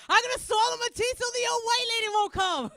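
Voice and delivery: high, loud panicked cry; freaked-out child. Acted. Son scared of the tooth fairy, panicked wail. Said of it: "But I freaked him out".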